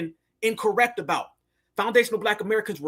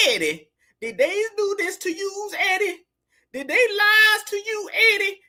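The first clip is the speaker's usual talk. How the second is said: mock tearful pleading; 12 semitones higher than usual. Commentator mockingly begging Eddie for answers, fake-tearful pleading. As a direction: plead in a hammed-up, crying high voice.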